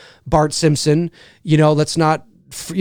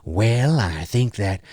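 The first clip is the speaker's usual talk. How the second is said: Reagan impression; 7 semitones lower, 4 dB quieter, slower than usual. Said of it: "do an impression of Ronald Reagan".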